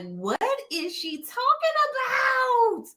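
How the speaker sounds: high put-on voice of a puzzled viewer; confused viewer. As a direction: squeak it out like a baffled fan. Voicing viewers' confused reaction.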